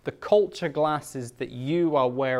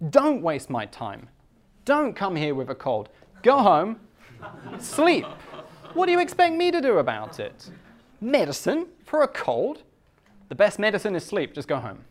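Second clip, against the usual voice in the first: much higher and louder impatient scolding; exasperated doctor; 5 semitones higher, 3 dB louder than usual. Retold or imitated. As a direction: shoo the patient out, fed up. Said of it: "he'll say".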